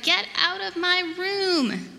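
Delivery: mock child's indignant shout; storybook shout. A narrator's reading. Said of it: "So I shouted".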